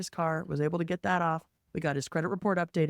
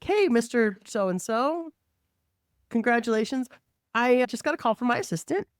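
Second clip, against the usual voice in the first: put-on cheery greeting; 7 semitones higher, 3 dB louder than usual. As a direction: greet the client with exaggerated politeness. Acts out awkward greeting to client.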